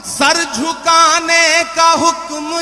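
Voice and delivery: loud, sung Urdu verse recital; stately nazm recitation. Acted. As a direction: chant each verse slowly with swelling force.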